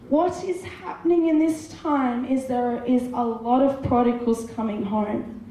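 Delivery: usual speaking voice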